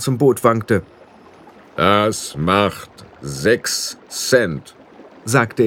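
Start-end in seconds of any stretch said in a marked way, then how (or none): 1.7-4.7 very slow, drawn-out boatman; a weary otherworldly ferryman. A narrator's reading. Ferryman demanding the fare from Roland, very slow and drawn out.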